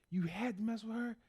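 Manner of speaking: hammed-up high wail, dragged out and breaking into a shout; pissed mother. Mother revealing the truth to the teacher, hammed-up fury. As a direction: build from high accusation to a slow tearful yell. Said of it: "the mother's just pissed".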